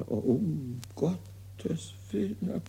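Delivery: put-on fussy old invalid grumbling over his bills; Molière's imaginary invalid, complaining to himself; hypochondriac Argan. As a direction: play the petty, self-pitying patient. Acts Argan's monologue.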